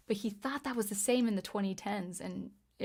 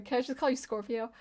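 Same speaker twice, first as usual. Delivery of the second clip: ordinary talk, nothing marked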